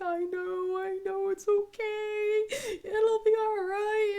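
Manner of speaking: high, slow, sing-song soothing; consoling. Retold or imitated. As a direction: imitate a mom comforting a crying child. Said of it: "trying to cheer her up".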